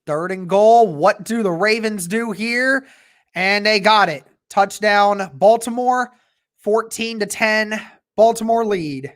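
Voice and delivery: steady game commentary; live play-by-play. Acted. Fan streaming the game, calling each play. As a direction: call the action as it happens.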